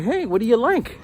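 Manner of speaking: high, bright coaxing teacher questions; teacher coaxes a silent boy, high and eager. Retold or imitated. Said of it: "I said".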